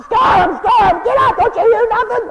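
high, loud screams to wake someone; panicked, hammed-up shouting; frantic yelling. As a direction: yell as if caught in a storm. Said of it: "he yelled until he was hoarse".

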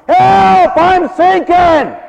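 high, drawn-out cry for help; long, loud, high-pitched shout; yelling for help. Acted. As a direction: yell it out loud like someone going under. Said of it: "by yelling out".

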